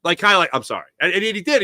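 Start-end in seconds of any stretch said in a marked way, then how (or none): 0.5-0.9 lower, softer quoted sorry; retold apology. Host imitating the quarterback's apology after a snotty answer, low and soft. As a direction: slip in a small, contrite apology. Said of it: "He apologized right there".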